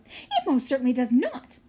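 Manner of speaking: loud, firm yell; hollering. A narrator's reading. Mother beaver backing her husband against pike, loud holler. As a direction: bark it out in outrage. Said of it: "hollered Missus Beaver".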